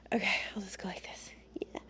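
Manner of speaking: quick, hushed mumble to self; barely voiced quick aside. Real, not acted. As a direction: whisper it quickly to yourself. Aside while adjusting position.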